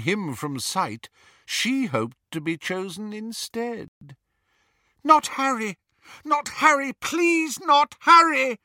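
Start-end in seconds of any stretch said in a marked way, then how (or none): 5.0-8.6 high, loud, frantic begging; desperate pleading. Acted. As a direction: beg for your child's life. Mother begs for her son.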